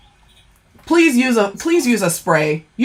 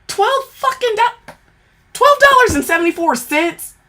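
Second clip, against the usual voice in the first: price shock; 11 semitones higher than usual. Real, not acted. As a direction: squeal at the price tag. Outraged at the price.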